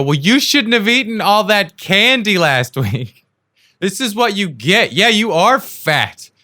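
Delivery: high, loud, drawn-out mock scolding; imitating a scolding partner. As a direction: rub it in, slow and high. Partner's scolding in retold quarrel.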